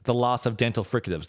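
ordinary talk, nothing marked

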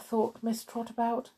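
ordinary delivery

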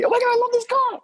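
mock the buyer raving about the car, way up high; gushing buyer.

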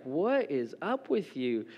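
a hint of a bewildered wife, drawn out; wife's puzzled look. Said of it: "she's just looking at me like".

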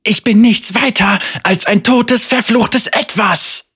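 high, loud despairing shriek. Acted. Senna shrieks at the voice that she is already dead, high and loud. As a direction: scream it out in anguish. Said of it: "kreischte sie".

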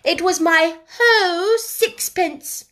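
put-on high, loud, slow child's voice; stout little boy. A narrator's reading. Small boy defending himself to sisters, stoutly. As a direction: proudly insist like a small boy.

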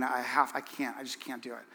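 gently acted regret, trailing to very quiet; pained apology. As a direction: imitate a hurt wife giving up, softly.

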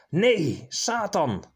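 loud, high cry of "Nee, Satan!"; horrified shout. Acted. Priest recoils from the demon woman, loud and high. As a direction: shout it out in horror.

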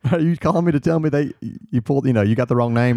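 mock-incredulous question through laughter; joking disbelief. Jokes about his own suspicion, laughing.

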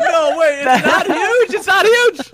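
high squeaky mock protest. Defending the hero's small bean, a high squeaky protest.

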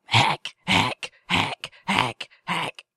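mock hacking cough. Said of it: "mimicked throat hacking".

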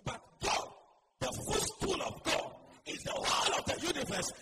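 loud, high, driving sermon; full-force preaching. Real, not acted. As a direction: hammer each statement at the congregation.

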